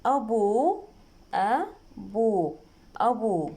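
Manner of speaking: أبو broken into "a... buu", then joined; spelling out. Shown as a demonstration. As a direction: sound out أبو slowly with a long final vowel. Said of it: "نجي نتهجى الموضوع". (تهجئة مقطعية)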